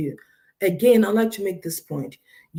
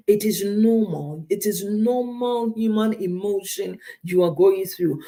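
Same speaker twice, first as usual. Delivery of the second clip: usual speaking voice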